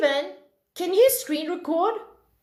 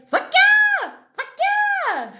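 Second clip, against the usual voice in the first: very high, squawked cheer; mock Romanian exclamation; 4 dB louder, much slower than usual. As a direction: screech it as a joke Romanian catchphrase. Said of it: "as the Romanians would say".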